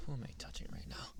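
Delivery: fast breathy whisper, close to the ear. Acted. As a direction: hush it out quickly as a secret. Whispered tease in roleplay.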